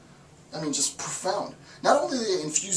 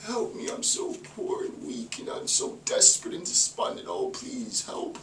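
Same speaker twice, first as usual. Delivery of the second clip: higher, soft, breathy whispered plea; mock whimpering beggar; 8 semitones higher, 3 dB quieter than usual. Student begging the institution, mocked in a high soft whimper. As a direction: whine feebly for help. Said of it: "suppose you're like".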